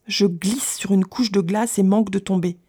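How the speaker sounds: ordinary delivery, nothing marked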